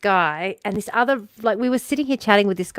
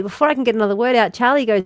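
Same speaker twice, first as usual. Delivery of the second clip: usual speaking voice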